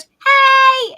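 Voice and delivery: high, loud cheerful shout; mock greeting shout from a viewer; shouted hey. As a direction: yell a happy greeting across the room. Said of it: "Patricia goes".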